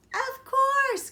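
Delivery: high, loud eager "of course"; bursting with eagerness; excited cry. A narrator's reading. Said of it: "cried Little Red".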